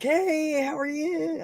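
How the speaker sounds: very high, bright greeting; high customer service voice. Retold or imitated. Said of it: "I always tried to".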